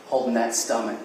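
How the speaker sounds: normal talking voice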